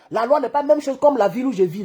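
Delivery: normal talking voice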